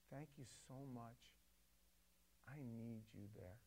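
soft, hushed and heartfelt; tender thanks. Retold or imitated. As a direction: say it gently, like meaning every word. Thanking a person who felt unseen.

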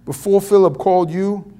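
put-on thick New York accent; hammed-up New York street talk; Brooklyn accent. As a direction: play it as a Brooklyn guy. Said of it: "thick Brooklyn accent here".

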